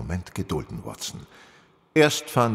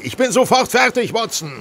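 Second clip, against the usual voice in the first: loud and much higher, called from the next room; calling out; 11 dB louder than usual. Acted. Holmes answering Watson from the dressing room, loud call. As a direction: shout through the door while dressing.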